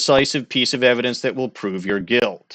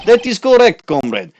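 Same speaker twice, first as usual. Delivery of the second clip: higher, louder hammed-up Russian accent; mock Russian tough guy; 6 semitones higher, 4 dB louder than usual. [name] scoffing at the defense lawyer, in a hammed-up Russian accent.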